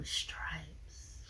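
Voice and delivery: whispered aside; soft, voiceless whisper; hushed. Real, not acted. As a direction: breathe it out in a whisper. Playful hushed reaction to stripes.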